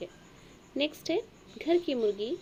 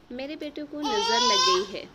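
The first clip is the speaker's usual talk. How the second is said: crying out loud in distress; tearful shout for her son; anguished cry; 3 semitones higher, 7 dB louder than usual. Acted. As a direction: wail it like a frightened mother. Acting a worried mother's cry.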